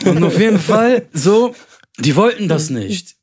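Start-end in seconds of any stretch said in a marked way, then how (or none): 0.1-1.5 laughing. Real, not acted. Laughing after being teased.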